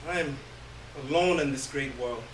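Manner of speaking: usual speaking voice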